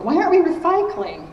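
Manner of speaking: high, loud mock-outraged question; imitated indignant kids. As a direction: play children challenging their parents. Voices kids challenging their parents.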